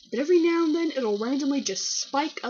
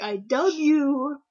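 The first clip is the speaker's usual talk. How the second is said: slow, louder, raised-pitch proclamation, lightly acted; mock ceremonial; 5 semitones higher, 6 dB louder, slower than usual. Friend dubbing them Zigbar, imitated mock ceremony. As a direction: bestow a title with mock pomp. Friend bestowing a character name.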